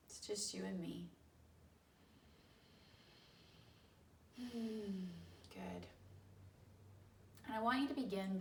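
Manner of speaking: soft meditation voice with long pauses; guided meditation. Real, not acted. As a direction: ease them gently into slowing down, unhurried.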